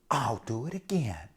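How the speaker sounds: soft secret whisper; whispered thought. A narrator's reading. Alice deciding to try again, whispered. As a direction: whisper it to yourself.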